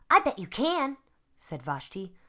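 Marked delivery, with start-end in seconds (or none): 0.1-1.0 encouraging. Acted. Vashti encourages boy.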